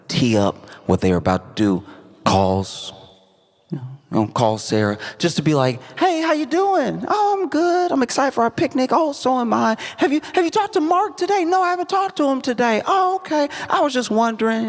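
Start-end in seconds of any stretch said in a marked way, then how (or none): 6.0-14.7 very high mother-daughter phone voices; chirpy phone chat. Acted. Mom calls daughter to prime the surprise, chirpy high chat. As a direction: act out a sing-song, high-pitched phone call.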